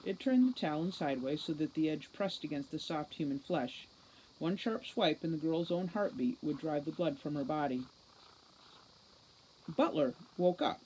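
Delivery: usual speaking voice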